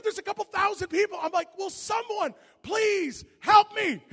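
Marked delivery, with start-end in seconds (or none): 1.6-4.0 high, loud, drawn-out cry for help; mock desperate plea. Preacher retelling his panic before thousands, hammed-up desperate plea. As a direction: wail it slowly in comic panic.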